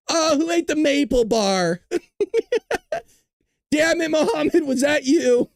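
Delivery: hammed-up annoyance at a coworker; mock office banter. Mock meeting voice continues.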